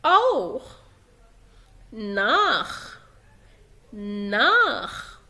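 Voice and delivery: أوخ and ناخ ending in throaty "kh"; isolated model words أوخ, ناخ with back-of-throat CH. Shown as a demonstration. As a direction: draw out ناخ into a rasped "naaakh". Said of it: "بينطق خ".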